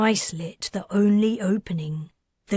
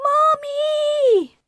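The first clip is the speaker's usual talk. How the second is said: put-on very high, loud child's cry; a frightened little girl calling out; child wailing; 5 dB louder than usual. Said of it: "The girl began to wail".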